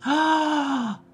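high drawn-out "ah" of dread; sharp rise into a held, panicked "ah"; frightened gasp. Retold or imitated. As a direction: re-enact a long, high gasp of alarm. Thinking in dread as detective visits.

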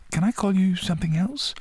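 put-on high-pitched little boy voice; young boy. A narrator's reading. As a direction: ask it high, like a curious small boy. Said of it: "said Cocky".

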